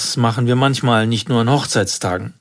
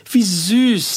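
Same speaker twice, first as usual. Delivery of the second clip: high, sweet little gush; friend's wife gushing; 9 semitones higher than usual. A narrator's reading. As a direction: coo it like a charmed woman. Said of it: "sagte Brunos Frau".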